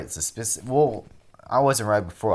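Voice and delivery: ordinary talk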